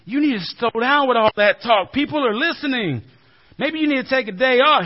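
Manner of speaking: high and loud, building to a shout; uneasy Peter. Retold or imitated. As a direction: Peter pulling Jesus aside, rising panic. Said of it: "Peter gets a little uncomfortable".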